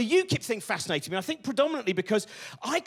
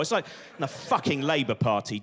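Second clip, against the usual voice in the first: ordinary talk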